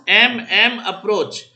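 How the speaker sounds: slow, drawn-out heading dictation; dictating. Real, not acted. Teacher giving the class a heading, dictating slowly. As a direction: space it out for students writing.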